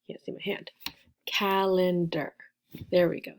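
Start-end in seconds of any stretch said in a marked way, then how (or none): 1.3-2.3 "Calendar" slowly split as cal-en-der; counting syllables. Shown as a demonstration. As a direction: give each beat of "Calendar" its own time, slowly. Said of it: "how many syllables in".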